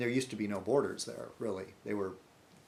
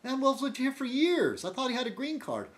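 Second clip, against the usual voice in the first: higher, startled disbelief; mock shocked reaction; retold surprise; 11 semitones higher than usual. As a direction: gullible neighbor hears the friend was deported, surprised. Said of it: "I said".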